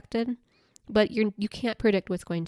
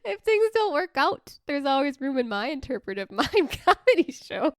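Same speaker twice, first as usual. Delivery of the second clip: real laughter breaking up the words; laughing helplessly; 9 semitones higher than usual. Real, not acted. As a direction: crack up at your own failed joke. Cracks up at failed joke.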